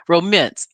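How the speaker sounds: "Romance" said as ro-MINCE; "Romance" with mince as its second syllable; mispronounced romance. Retold or imitated. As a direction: pointedly echo "Romance" as "ro-ments". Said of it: "That's how she pronounced it".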